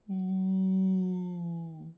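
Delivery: long, high wind "ooh"; imitated wind whistle; howling wind. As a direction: sustain an eerie high hoot like wind. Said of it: "the wind laughed at everyone".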